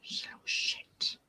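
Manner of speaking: full whisper, just breath; whispered aside. Real, not acted. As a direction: tell the camera secretly she's a pest. Aside about the cat.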